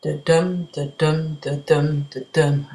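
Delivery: soft "da-dum" repeated, stress on "dum"; heartbeat rhythm. Shown as a demonstration. A teacher softly taps out iambic feet like a heartbeat. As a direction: pulse it like a heartbeat. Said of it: "sounding like a heartbeat".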